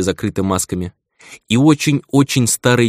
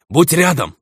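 sharp, raised warning shout; urgent call; 8 semitones higher than usual. Acted. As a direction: call out to stay close. Character's urgent warning in battle.

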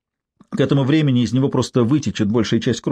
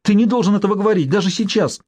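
мольба; 7 semitones higher than usual. A narrator's reading. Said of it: "взмолилась".

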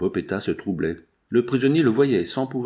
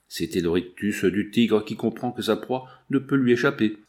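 ordinary delivery, nothing marked

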